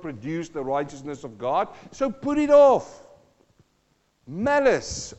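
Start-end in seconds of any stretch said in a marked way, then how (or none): 1.9-5.1 high, loud, slow command; emphatic shout. Real, not acted. Preacher calling the congregation to drop wrath, loud slow shout. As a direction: bellow the order to the whole church.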